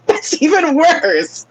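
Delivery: words squeezed out through laughter; laughing so hard the words barely come out. Real, not acted. Cracking up at a friend's joke, laughing through the words.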